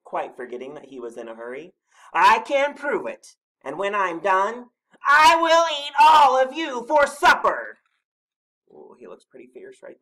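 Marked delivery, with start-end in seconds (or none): 2.1-7.8 slow, high, huge monster bellow; bellowed threat. A narrator's reading. Snorklum threatening all three animals, bellowing. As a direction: build to a fierce shouted threat. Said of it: "bellowed the Snorklum".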